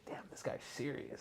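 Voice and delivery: very soft, nearly voiceless retold reaction; hushed aside. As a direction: drop to a whisper, as if thinking aloud. Retelling own awed reaction to video.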